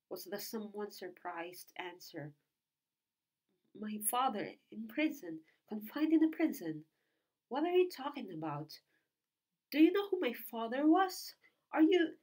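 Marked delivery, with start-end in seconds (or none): 3.7-12.2 rising higher and louder with each question; from shaken to almost shouting; agitated demand. A narrator's reading. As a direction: disbelief building into an outburst. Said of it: "demanded the young man".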